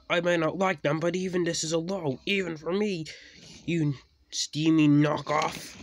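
high, loud indignation; angry retort. A narrator's reading. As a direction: fire back loudly at the culprit. Diesel retorts in quarrel.

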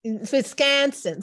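slow, high, drawn-out Chicago accent; stretched Chicago-accented place name. Shown as a demonstration. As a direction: show off your Chicago accent. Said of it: "with your Chicago accent".

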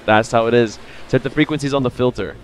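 ordinary delivery

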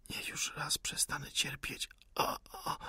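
faint, voiceless plea into moans; suffering whispered plea. A narrator's reading. Wounded insurgent begs to stop suffering, whispering. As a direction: whisper it, then moan softly.

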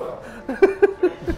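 high-pitched burst of laughter. Real, not acted. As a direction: break into a sudden high laugh. Laughs at own joke.

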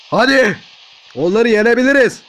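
rally your robot to win; rousing pep talk. Pretend.

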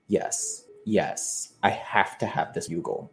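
soft, half-whispered yes; retold covetous hush. Thief eyeing a bugle in Switzerland, covetous hush. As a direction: imitate quietly coveting something.

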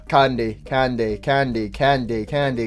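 low, soft, repetitive robot hawker; monotonous candy vendor. Acted. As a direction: drone on selling candy in a low voice. Candy Cadet hawking candy nonstop.

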